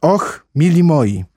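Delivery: high, drawn-out dismay; plaintive cry. A narrator's reading. Alice sees she offended the mouse, plaintive. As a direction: wail it slowly in regret. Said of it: "zawołała Alicja żałośnie".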